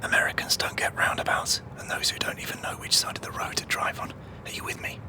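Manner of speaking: hushed conspiratorial whisper, fading. Real, not acted. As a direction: whisper the plan out of earshot. Whispering secret plan.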